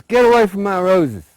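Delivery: high, loud furious shout; angry yell. Acted. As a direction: holler it at the pigs. Old woman yelling again.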